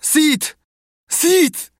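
high, loud shout to wake a sleeper; calling out. A narrator's reading. Tomek angrily rousing his snoring brother Sid, shouting. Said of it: "Zawołał".

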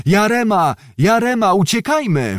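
frightened men on the ferry shouting to flee; panicked calls. A narrator's reading. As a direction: yell in fear to get away. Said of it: "wołali inni".

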